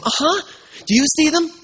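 bursting that someone finally believes him; excited child. Acted. Little boy eagerly asks the pastor if he sees the notes too.

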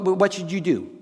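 ordinary talk, nothing marked

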